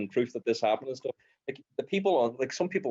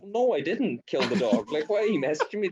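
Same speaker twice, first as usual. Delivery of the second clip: higher, mildly exasperated reply; 9 semitones higher than usual. Retold or imitated. Answering trolls about the dog, mildly exasperated. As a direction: push back at the trolls, baffled. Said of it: "I'm like".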